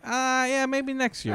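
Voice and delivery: put-on high voice, brushing it off; shrugging cult leader. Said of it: "the guy's just like".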